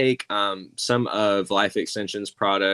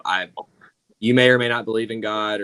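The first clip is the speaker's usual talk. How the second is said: ordinary delivery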